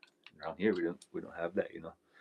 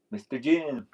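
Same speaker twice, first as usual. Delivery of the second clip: loud, raised greeting call; hailing a viewer; 6 semitones higher, 10 dB louder, slower than usual. Real, not acted. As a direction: call out his name in hearty hello.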